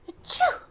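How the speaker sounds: very high sneeze; a big acted sneeze. Sneeze in story.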